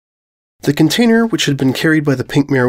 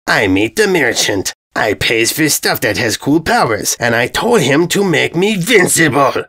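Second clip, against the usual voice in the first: triumphant dim invader; 3 semitones higher than usual. Acted. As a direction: crow about a stupid bargain. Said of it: "triumphant declaration".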